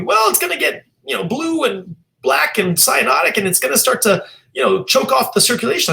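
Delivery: put-on high-pitched old-lady New York accent. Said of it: "she said to me".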